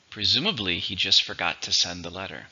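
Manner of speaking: ordinary delivery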